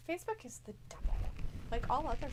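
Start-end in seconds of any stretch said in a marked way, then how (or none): none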